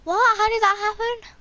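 high, loud shocked outburst. Real, not acted. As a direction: blurt it out in shock. Sudden game event.

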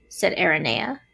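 plain reading voice

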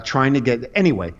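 ordinary talk, nothing marked

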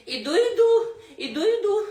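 put-on high, loud cackling old woman; old granny calling. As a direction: call out as a creaky granny.

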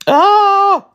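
loud high acted scream; sudden high yell. Trapped character cries out.

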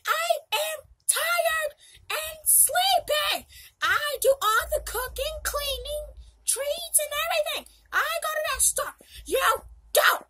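high, loud, fed-up yelling; exasperated rant. Pretend. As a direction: rant at the whole family. Mom rant, tired and sleepy.

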